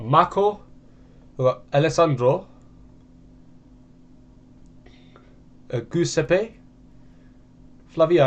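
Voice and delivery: names with Italian vowels and lilt; Italian accent. Shown as a demonstration. Trying Italian names for viewers in an Italian accent. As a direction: say "Marco" and "Giovanni" like an Italian. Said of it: "I'm gonna try and pronounce it".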